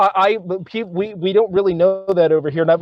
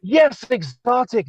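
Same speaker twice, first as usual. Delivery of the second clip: slow, higher, drawn-out swoon; mock dreamy voice; 7 semitones higher, much slower than usual. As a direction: play along dreamily. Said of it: "echoes mock voice, slow".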